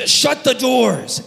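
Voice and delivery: slow, firm order; drawn-out command to shut them; commanding Joseph. Retold or imitated. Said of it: "Joseph says".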